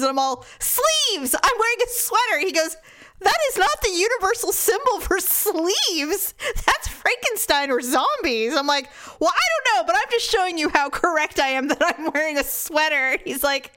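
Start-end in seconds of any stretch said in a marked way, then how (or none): 0.6-2.4 high-pitched, mock-exasperated explanation; indignant retort. Wife explains her outstretched arms to husband, mock indignation. Acted retort to puzzled partner. 3.2-8.5 raised-pitch, hammed-up correction; mock exasperated objection. Husband corrects wife's sign for sleeves, mock exasperation. 9.2-13.1 high, insistent mock defensiveness; defensive comeback. Wife proves to husband she was right, mock defensiveness.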